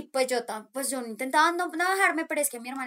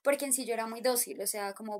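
ordinary talk

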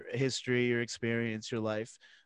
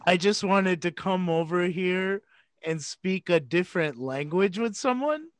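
incredulous quote; 5 semitones higher than usual. Retold or imitated. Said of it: "He said".

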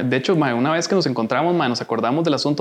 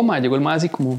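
normal talking voice

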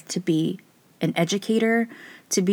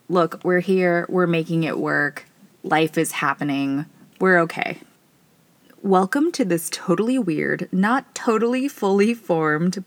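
normal talking voice

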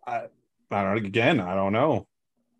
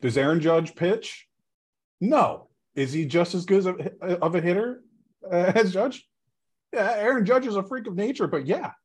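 higher-pitched, blunt rhetorical questioning; retold blunt argument, pitched up; 9 semitones higher than usual. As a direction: imitate a pundit bluntly making his case. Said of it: "put it very bluntly".

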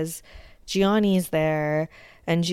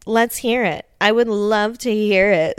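higher, louder taunting dare; mock defiant shout; 4 semitones higher, 7 dB louder than usual. Snooki daring the Unit, loud mock defiance. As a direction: dare him loudly to say it. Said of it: "She's like".

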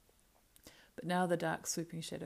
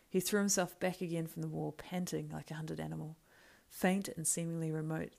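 usual speaking voice

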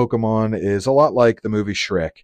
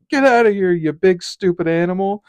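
much higher, loud cartoon yell; squeaky cartoon dismissal, pitched way up; cartoon shoo-away; 10 semitones higher, 5 dB louder than usual. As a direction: bark it like a cartoon character chasing off a seahorse. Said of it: "the old SpongeBob episode with the seahorse".

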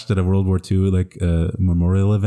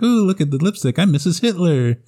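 put-on much higher, playful little-girl voice; girly daughter voice; 11 semitones higher than usual. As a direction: ham up a giddy young daughter admiring herself. Daughter voiced finding lipstick.

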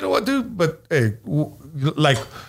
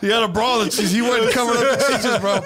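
laughing while talking; 6 semitones higher than usual. Real, not acted. Laughing while telling the story.

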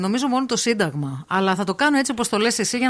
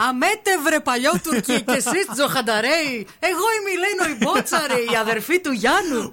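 poem recitation; 6 semitones higher than usual. Pretend. Host reciting the poem she could record, high declamation. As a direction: proclaim it like a defiant folk heroine. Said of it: "ποίημα".

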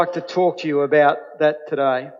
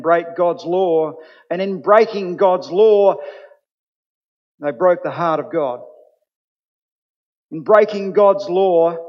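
preaching; 3 semitones higher, 4 dB louder than usual. Acted. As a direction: build the message with rising conviction. Preacher builds to full force.

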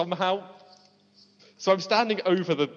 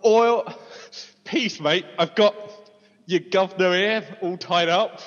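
put-on tough guy British accent; 2 semitones higher, slower than usual. Player threatening cultists as a British thug, hammed-up accent. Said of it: "I do my best tough guy British accent".

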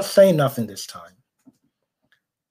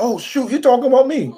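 high-pitched, startled imagined reaction; mock alarm; 9 semitones higher than usual. Former classmate learning he is being talked about, mock alarm. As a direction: squeal it lightly, as if caught out.

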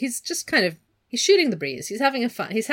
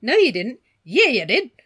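higher, louder Australian back-and-forth; hammed-up Australian squabble; playful Australian "yeah, you did"; mock Australian bickering; 4 semitones higher, 7 dB louder than usual. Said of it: "they've suddenly both become Australian".

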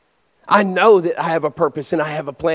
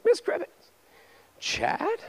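high, soft mock surprise; acted surprised recognition; 14 semitones higher, 6 dB quieter, much slower than usual. Preacher and old teacher meet in Target, high surprised recognition. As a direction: lift it high, spotting someone from long ago.

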